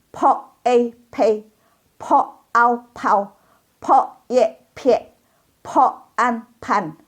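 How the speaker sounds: spaced syllables, air bursting from each p; aspirated p. Shown as a demonstration. Teacher leading spelling drill, strongly aspirated p. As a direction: slowly drill each p with a puff. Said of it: "udaranya keluar semua".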